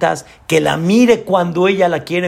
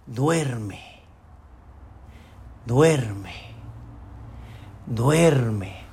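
much softer, low, drawn-out "duerme"; soft lulling; 6 semitones lower, 7 dB quieter, much slower than usual. Acted. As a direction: lull him slowly to sleep. Angel lulling the man to death.

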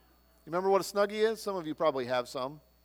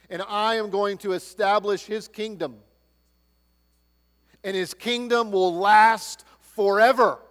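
God's promise, building; 6 semitones higher, 9 dB louder than usual. Acted. Preacher voicing God's promise to David.

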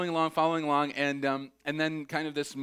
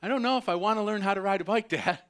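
put-on rushed, higher, hesitant kid pleading with Dad; nervios de niño; 7 semitones higher, 5 dB louder, faster than usual. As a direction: imitate a kid getting cold feet, fast and high. Kid retelling his fear to dad.

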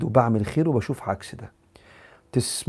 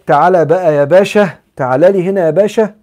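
loud, higher, sarcastic summons of a "pasha"; mocking call; 5 semitones higher, 9 dB louder than usual. Preacher mocks a listener by calling him باشا, loud and sneering. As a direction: call him over as "باشا" dripping with ridicule. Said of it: "أنا بتريق عليك".

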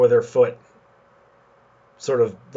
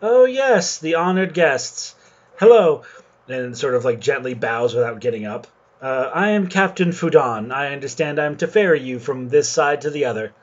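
foppish captain; 3 semitones higher, 3 dB louder than usual. Acted. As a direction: play the preening ship captain, pitched up. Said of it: "looks you all and goes".

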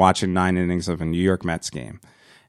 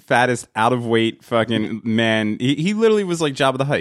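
ordinary talk, nothing marked

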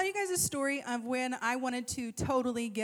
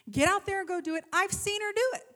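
raised, higher, exasperated mom voice; fighting with her; 7 semitones higher, 5 dB louder, faster than usual. Retold or imitated. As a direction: argue loudly with a stubborn kid. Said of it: "I'm fighting with her".

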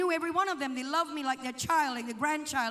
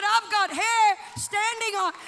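higher, louder, slow emphatic quote; worked-up retelling; 5 semitones higher, 7 dB louder, slower than usual. Retold or imitated. Father describing his goosebumps to son, agitated. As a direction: build toward a shout, stretching the words. Voices father gripped by presence.

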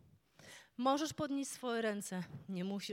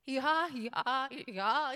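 louder mock bray of a donkey; hee-haw it like a stuck donkey; 3 semitones higher, 7 dB louder, much slower than usual. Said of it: "jak się nazywa wydawanie dźwięków przez osła". (naśladowanie ryku osła)

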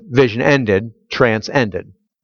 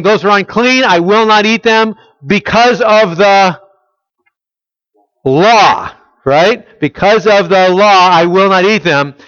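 Peter refusing; 6 semitones higher, 4 dB louder than usual. Retold or imitated. Peter's stance voiced.

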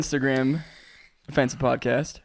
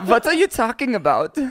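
higher, hammed-up German accent; 4 semitones higher than usual. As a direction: protest in a mock-German accent. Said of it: "You still gotta do the German".

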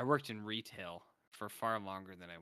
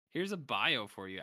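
usual speaking voice

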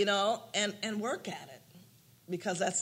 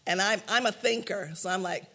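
ordinary talk, nothing marked

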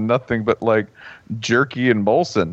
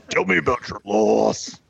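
higher, strained wrestler impression; Randy Savage; 4 semitones higher than usual. Wrestler as grief counselor, Macho Man voice. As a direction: growl it out like a wrestling legend. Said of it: "would be Randy Macho Man Savage's".

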